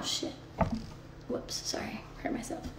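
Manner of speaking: hushed whispered apology; whispering. Real, not acted. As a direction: whisper it under your breath. Bumps herself, mutters apology.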